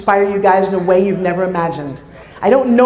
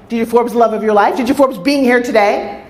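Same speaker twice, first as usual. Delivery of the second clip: ordinary delivery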